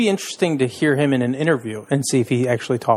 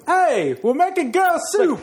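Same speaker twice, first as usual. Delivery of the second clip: much higher actor impression; Christopher Walken; slower than usual. Asked to redo the punchline, high-pitched impression. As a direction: belt the punchline in a celebrity voice. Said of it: "say it with a Christopher Walken accent".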